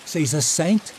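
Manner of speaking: put-on high, stubborn boy insisting; lightly voiced obstinate boy. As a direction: dig in like a defiant kid. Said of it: "Liam said obstinately".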